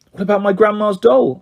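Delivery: high, mildly worried question; anxious. A narrator's reading. Girl asking friend about grandma's doll, mildly anxious. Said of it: "I asked anxiously".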